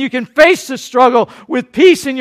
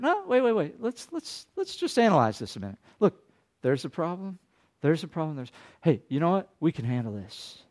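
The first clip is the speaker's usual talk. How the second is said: calm soothing; 5 semitones lower, 11 dB quieter than usual. Retold or imitated. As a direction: settle a panicking friend down. Calm friend slows him down.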